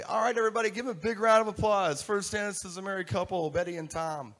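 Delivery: loud, high wedding MC announcement; DJ announcer. Shown as a demonstration. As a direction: whip the room into applause as the DJ, easing slightly on the names. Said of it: "What I used to do is say".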